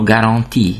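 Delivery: garantie starting on a hard G. Shown as a demonstration. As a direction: say garantie in French, "ga-ron-tee" with nasal middle. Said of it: "akkor G-nek ejtjük".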